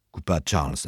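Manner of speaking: plain reading voice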